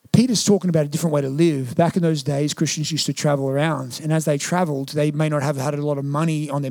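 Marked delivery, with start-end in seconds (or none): none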